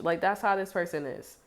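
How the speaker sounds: usual speaking voice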